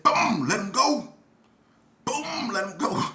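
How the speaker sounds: loud, high acted "boom"; punchy retold command. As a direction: boom it out, imitating the blow.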